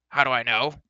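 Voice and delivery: loud, indignant repeat; testy outburst, rising at the end; snapped. A narrator's reading. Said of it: "snapped Dolly".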